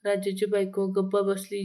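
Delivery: usual speaking voice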